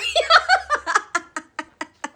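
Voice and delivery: high, long cackling fit; helpless laughter. Real, not acted. Cracking up at the wolf bit, helpless real laughter. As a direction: laugh until out of breath.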